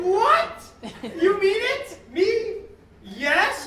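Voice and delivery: high, loud, hammed-up amazement; flattered astonishment. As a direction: squeal it like a shopper who just won. Acted shopper flattered by offer.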